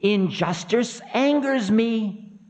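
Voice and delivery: high, sharp warning; indignant high voice of Time; sharp anger. Acted. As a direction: snap a high-pitched threat. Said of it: "said Time sharply".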